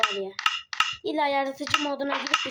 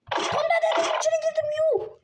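high, loud, fast triumphant yell; thrilled gamer outburst; excited shout; 4 dB louder than usual. Real, not acted. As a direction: shout it out in amazement. Lands exactly in the hole.

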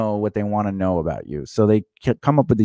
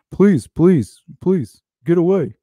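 hammed-up pleading; 6 semitones higher, 4 dB louder, slower than usual. Acting out bad casting read.

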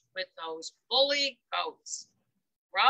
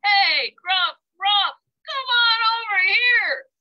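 loud, higher call to his brothers; 7 semitones higher, 6 dB louder than usual. Acted. Goat calling his brothers over, loud. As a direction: shout them over. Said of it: "Then he called".

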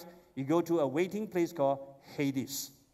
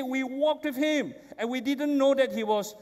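excited retelling; 6 semitones higher, 3 dB louder than usual. Acted. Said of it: "He said".

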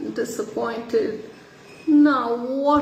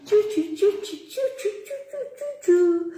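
high chirping birdsong; sparrow chirping; 11 semitones higher, faster than usual. Acted.

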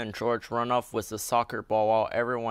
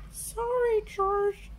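put-on high little girl apology; Peppa Pig voice; slower than usual. A narrator's reading. Peppa apologises to little brother George, high voice. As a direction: say sorry sweetly. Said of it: "says Peppa".

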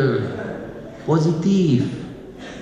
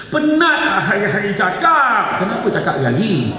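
exasperated mother; 5 semitones higher, 4 dB louder than usual. Pretend.

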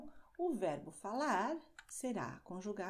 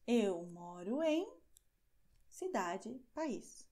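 slow and deliberate, a pause before each slot; patient classroom demo; slow model answer; slower than usual. Shown as a demonstration. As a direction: lay out the answer pattern slowly. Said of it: "teacher models answer".

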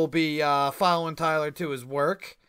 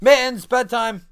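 loud firm call; calling the dog; 7 semitones higher, 8 dB louder than usual. Real, not acted. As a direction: announce bedtime to the pet.

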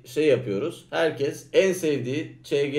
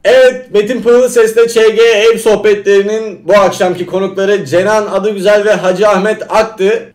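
loud announcer sign-off; show host outro; 4 semitones higher, 13 dB louder than usual. Acted. Program closing announcement.

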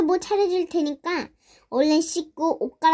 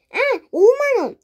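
flustered, quick blurt, pitched up; 당황한 대답; 5 semitones higher, 4 dB louder, faster than usual. Acted. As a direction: blurt it out as if caught off guard. 액수를 줄여 급히 대답. (당황해서 높고 빠르게)

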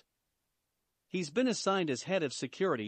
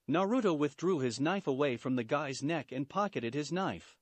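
ordinary delivery, nothing marked

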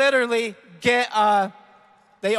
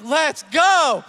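excited cheer; 4 semitones higher, 6 dB louder than usual. Acted. Imagined winner celebrating.

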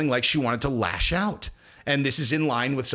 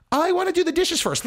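high, giddy squeal; mock giddy high; 3 dB louder than usual. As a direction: squeal it like a delighted kid. Blissed-out high voice.

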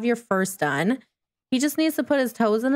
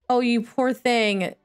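ordinary delivery

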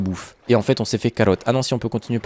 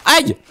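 high, sudden "ow"; short high-pitched ouch; yelp of pain; 14 semitones higher, 4 dB louder than usual. Real, not acted. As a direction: cry out as if pricked. Gets hurt in the game.

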